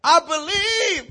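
high, loud, drawn-out cry. Acted. Preacher acts the father pleading for belief, crying out. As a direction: belt out the plea, stretching it long.

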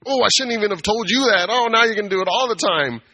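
hammed-up oh-no whine; mock dismayed coworker. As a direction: play the coworker groaning at her mistake. Coworker regrets telling him.

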